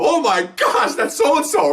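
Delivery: high, urgent exclamation; re-enacted startled reaction; alarmed recognition. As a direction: blurt it out high as you recognize the caller. Reacting to officer's distress call.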